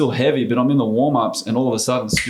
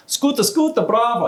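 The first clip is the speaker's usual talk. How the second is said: Italian owner yelling praise; 12 semitones higher than usual. Retold or imitated. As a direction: belt it out like a thrilled restaurant owner. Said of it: "And he's yelling in the restaurant".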